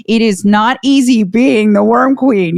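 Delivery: normal talking voice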